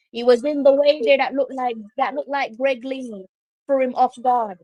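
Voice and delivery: ordinary talk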